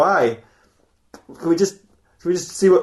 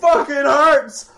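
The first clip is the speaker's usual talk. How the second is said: hammed-up metal creature screaming in pain; pained shout; 9 semitones higher, much slower than usual. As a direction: bellow it out like a broken metal man. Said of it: "lying there going".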